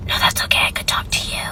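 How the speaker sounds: faked raspy whisper, barely voiced; mock sore-throat whisper. Caller faking a sore throat to the cashier, whispered. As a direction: whisper like you can't use your voice.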